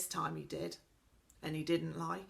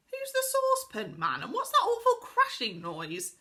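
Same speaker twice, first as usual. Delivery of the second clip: put-on high girl's voice, puzzled and a touch alarmed; curious little girl; 12 semitones higher, 6 dB louder than usual. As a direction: ask as a startled child hearing a crash. Connie asks, voiced character.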